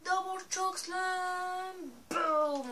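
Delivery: high, loud, stretched-out move call; wrestling commentator. Pretend. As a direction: shout the finisher like commentary. Calling finisher during match. (commentateur de catch qui crie la prise)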